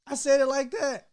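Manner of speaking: high, loud disbelief; husband hearing his own words acted back, stunned; shocked disbelief. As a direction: gasp it out, caught off guard.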